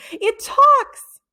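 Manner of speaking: overjoyed cry; beaming joy. A narrator's reading. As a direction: shout it out in triumph. Jeff beaming.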